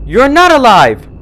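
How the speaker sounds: high, loud terrified scream. A narrator's reading. Twilight screams at the doll, terrified. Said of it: "Twilight screamed".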